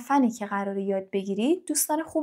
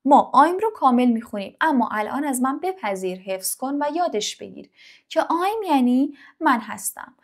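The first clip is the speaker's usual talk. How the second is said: usual speaking voice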